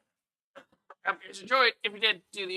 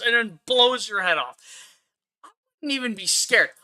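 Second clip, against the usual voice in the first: ordinary talk, nothing marked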